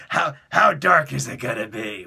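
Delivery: loud gruff boss asking; boss question. Retold or imitated. Boss asking the writers how dark, loud. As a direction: demand it like the chairman.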